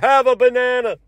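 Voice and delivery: high put-on banana guy voice; high mock character call. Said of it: "same guy as the banana guy".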